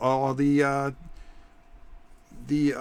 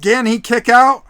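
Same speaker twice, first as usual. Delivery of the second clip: louder, higher urgent question; excited; 10 semitones higher, 13 dB louder than usual. Acted. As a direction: ask it on the edge of your seat. Tense near fall, raised voice.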